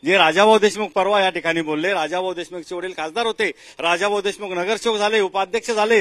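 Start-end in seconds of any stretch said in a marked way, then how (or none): none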